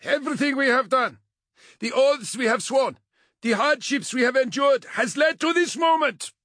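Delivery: high, loud rallying speech; passionate shout. A narrator's reading. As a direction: rouse the troops with a commander's fervour. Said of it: "Egu shouted, not simply to be heard, but filled with passion".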